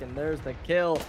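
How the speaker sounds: fast hyped play-by-play; esports caster. Acted. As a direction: call the kills with rising excitement. Live play-by-play of kills.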